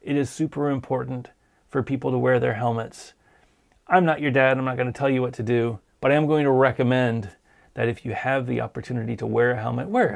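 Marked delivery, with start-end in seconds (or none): none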